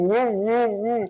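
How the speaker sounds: high, loud wordless woo imitating a shouting voice; playful high woo noises for muffled shouting; muffled yelling through wall. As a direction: mimic yelling heard through a thin wall. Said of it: "all they hear is this woo".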